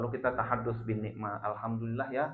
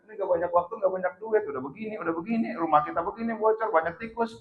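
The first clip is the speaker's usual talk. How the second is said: imitated wifely whining, pitched higher; complaining; 7 semitones higher, 3 dB louder, faster than usual. Wife lists troubles with their house, mock complaining. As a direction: rattle off household grievances in mock nagging. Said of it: "Tadinya ngeluh".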